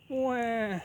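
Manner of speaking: put-on high, wailing baby cry; shrill infant "wah". A narrator's reading. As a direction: wail like a hungry newborn. Baby ghost cries again.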